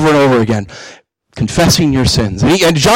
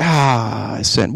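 deflated admission; 5 semitones lower, 5 dB quieter, much slower than usual. Acted. Same believer after sinning.

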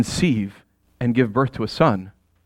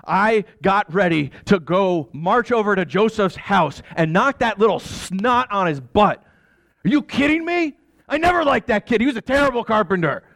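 put-on high, heated rant about the boy; seething father ready to storm out; fuming; 7 semitones higher than usual. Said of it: "As I was fuming".

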